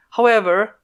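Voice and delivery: plain reading voice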